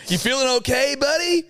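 very high, projected MC voice; hammed-up host checking on a contestant; event host. Host questions the blankly staring contestant, high announcer voice.

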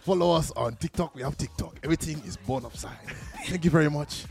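playful Nigerian dad sign-off; dad's Nigerian accent. Acted. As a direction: imitate a Nigerian father signing off to fans. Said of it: "imagine it's your dad doing it".

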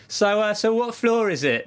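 British woman in the lift. Retold or imitated. British woman in the hotel lift asking, high mock voice. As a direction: chirp the question like a cheerful stranger. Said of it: "she was like".

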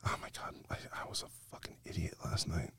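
barely audible, voiceless regret; hushed, mortified whisper; whispered self-reproach. Retold or imitated. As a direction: whisper it to yourself in hungover shame. Acted hungover self-reproach.

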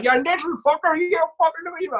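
put-on high-pitched furious cursing from a dazed old man; swearing Irish man. Crash victim swearing at the young driver, in a high mock screech. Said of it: "he started swearing at me".

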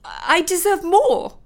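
high, loud, slow shriek; full shriek of indignation. Acted. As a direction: screech it at him. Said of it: "she shrieked".

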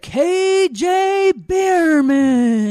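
very high, slow, drawn-out stadium call; mock announcer shout. Imitating the walk-up announcement for a child, announcer shout. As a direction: stretch it out like a ballpark announcer. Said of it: "it's like a computer voice saying it".

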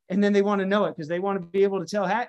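ordinary talk

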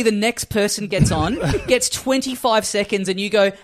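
ordinary talk, nothing marked

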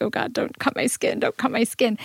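breathless, whispered plea not to be cut; frightened whisper. Retold or imitated. Speaker afraid husband's scissors cut her skin, whispering in fear.

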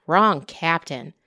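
ordinary delivery, nothing marked

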